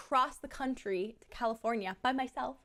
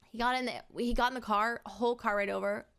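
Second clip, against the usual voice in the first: normal talking voice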